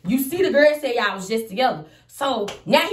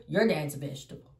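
much lower and softer, deadpan; flat low quote; 7 semitones lower, 6 dB quieter than usual. Retold or imitated. Quoting the ex's insult to followers, low and flat. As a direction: drop the voice and say it dead flat.

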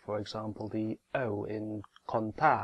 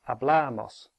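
hablamos said louder, with the stress on "bla"; 6 dB louder than usual. Shown as a demonstration. Teacher repeats the word for learners, stressing "bla" louder. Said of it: "the stress is on the penultimate one".

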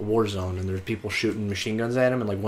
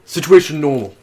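guard report; 3 semitones higher, 8 dB louder than usual. Acted. Voices oblivious game guard.

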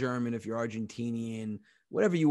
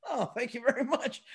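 high, gushing mock thanks; mock flattered; 11 semitones higher than usual. Comedian thanks a shopper at the store, mock flattered. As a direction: thank them in a raised, flattered voice.

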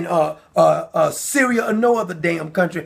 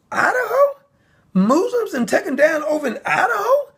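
much higher, rising in shocked disbelief; a real shocked double take on the place name; incredulous disbelief; 9 semitones higher than usual. Real, not acted. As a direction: ask it as if you can't believe it. Disbelief on reading the story.

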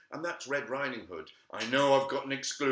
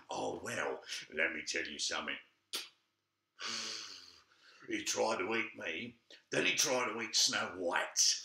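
a shady cigar-smoking tough guy; gangster gingerbread man; 2 semitones lower than usual. Acted.

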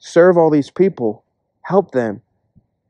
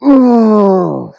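mock reluctant groan; 6 semitones higher, 5 dB louder than usual. Reacting to being told church.

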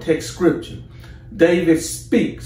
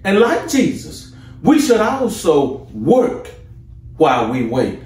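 rising, fervent preaching, pitched higher; 4 semitones higher than usual. Acted. Preacher rousing the church about Jesus' works, fervent and high. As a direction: lift the congregation, building fire.

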